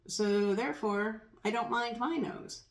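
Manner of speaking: normal talking voice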